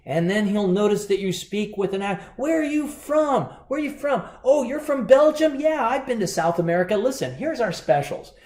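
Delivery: high, bright-eyed server patter; chirpy American waiter. Retold or imitated. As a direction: greet them like an eager waiter working the table. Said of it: "some guy who walks up to you and say".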